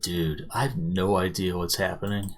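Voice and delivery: low, quiet, slowed aside to a friend; hushed aside. Acted. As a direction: lean in and murmur it under your breath. Craig leans in to whisper.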